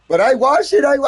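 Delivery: high loud whiny protest; mimicking the roommate pleading her case; Lita protesting. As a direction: plead innocence, squeaky and loud. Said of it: "she's like".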